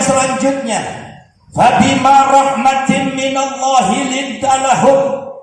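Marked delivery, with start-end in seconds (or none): 1.5-5.3 slow, high, chanted Quran recitation. Acted. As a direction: intone the verse slowly.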